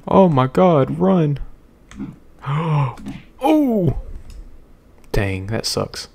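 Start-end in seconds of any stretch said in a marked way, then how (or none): 0.1-4.0 panicked. Real, not acted. Fleeing then dying.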